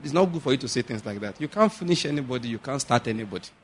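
gently appease, then quietly correct him; very nicely. Shown as a demonstration. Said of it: "Very nicely".

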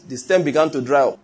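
normal talking voice

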